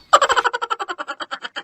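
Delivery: high giddy burst of laughter; squealing little laugh; high cackling laugh. Acted. Crowd laughing at joke.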